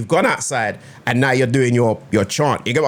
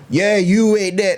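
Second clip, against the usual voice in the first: mock lyric delivery; 6 semitones higher, slower than usual. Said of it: "all he does".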